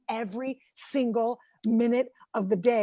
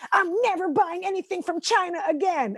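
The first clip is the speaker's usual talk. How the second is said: higher-pitched mock indignation; pissed-off friend; 7 semitones higher than usual. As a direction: imitate a friend's outraged vow. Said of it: "a friend that's really pissed off".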